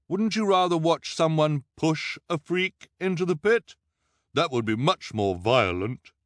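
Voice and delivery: high, loud put-on voice; persuasive disguised voice. A narrator's reading. Violet in disguise egging on the crowd, loud persuasive put-on voice. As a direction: goad the listeners loudly. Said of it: "Violet said, thinking quickly".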